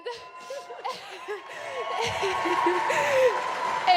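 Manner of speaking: laughing through tears; real crying laugh; teary laugh. Real, not acted. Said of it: "I am so emotional".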